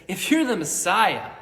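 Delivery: loud, high, sneering challenge; mocking taunt. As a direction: taunt him from the cross. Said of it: "mocks him, insults him".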